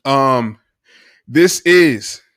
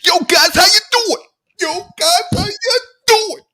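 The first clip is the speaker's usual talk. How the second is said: Philly five hundred impression. Podcast host imitating a YouTuber's high-pitched greeting. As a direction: squeal it like an excited YouTuber. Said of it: "I always wanted to do a Philly five hundred person nation".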